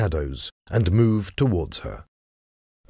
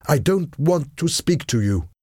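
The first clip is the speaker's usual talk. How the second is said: high, emphatic, mildly raised voice; shouted; 9 semitones higher than usual. A narrator's reading. Shocked lady refusing to speak to him, shouting mildly. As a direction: reject him in a high, upset voice. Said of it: "she shouted".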